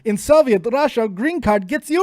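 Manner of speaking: loud, high-pitched Russian comedian catchphrase; booming mock-Russian accent; Yakov Smirnov. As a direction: deliver it as a big Russian stand-up punchline. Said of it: "That was Yakov Smirnov".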